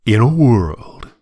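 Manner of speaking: slow, dramatic "In a world"; a hint of the trailer announcer; movie trailer voice. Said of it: "best known for starting many trailers by saying".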